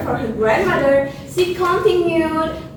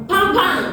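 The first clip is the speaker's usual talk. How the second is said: two higher, popped shot noises; spoken bang-bang sound effect; imitated shots; 4 semitones higher than usual. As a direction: voice the hunter's shots.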